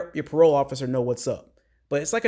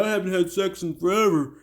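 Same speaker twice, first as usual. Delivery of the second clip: high put-on voice of denial; lightly hammed-up protest; mock protest; 4 semitones higher than usual. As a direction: imitate him pleading innocence. Imitating the man's protesting denial.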